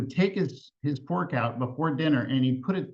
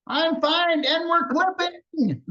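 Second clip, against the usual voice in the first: put-on stubborn old grandmother; 14 semitones higher, 3 dB louder, slower than usual.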